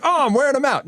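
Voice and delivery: smug dad. Retold or imitated. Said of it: "We're always like".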